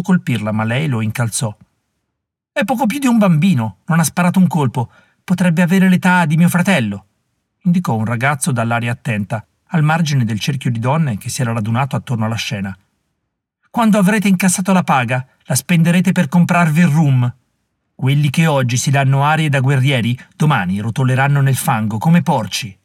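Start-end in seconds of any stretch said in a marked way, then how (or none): 2.5-7.1 put-on fierce young woman. Molly rebuking the warriors, fierce and scornful. 13.7-22.8 put-on fierce young woman. Molly rebuking the warriors, fierce and scornful.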